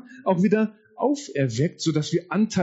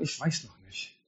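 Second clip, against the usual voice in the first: much softer, lower, unsure; quiet hesitant doubt; 4 semitones lower, 11 dB quieter than usual. Retold or imitated. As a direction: let it trail away quietly. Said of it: "die Leute sagen". (leise, zögernd, tiefer)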